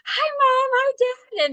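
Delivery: put-on sweet childlike hi to mom and dad; childlike greeting. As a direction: chirp hello like a child seeing her parents. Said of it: "I just turned and looked at my mom and I'm like".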